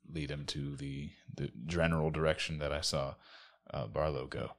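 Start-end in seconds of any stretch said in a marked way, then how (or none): none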